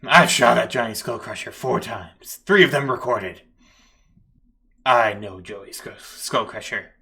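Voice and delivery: loud, indignant detective; hammy detective's loud outburst; storming detective. Acted. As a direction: insist forcefully on your expertise. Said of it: "kinda storms forward".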